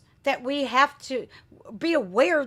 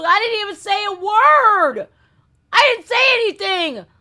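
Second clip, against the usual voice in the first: higher and louder, fed up; exasperated outburst; 8 semitones higher, 8 dB louder than usual. Real, not acted. Creator venting to viewers about flagged video, exasperated. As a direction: protest your innocence loudly.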